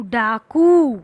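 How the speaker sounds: डाकू said whole after its letters; blended word. Shown as a demonstration. Teacher showing children, blending डाकू. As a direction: join the sounds into डाकू. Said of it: "वैसे ही बिल्कुल ड आ क उ".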